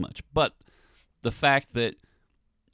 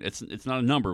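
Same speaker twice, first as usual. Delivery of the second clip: ordinary delivery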